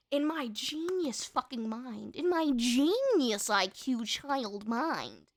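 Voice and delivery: slow, drawn-out mock grandeur; self-mocking. Real, not acted. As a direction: stretch out the boast with irony. Mocks her childhood genius idea.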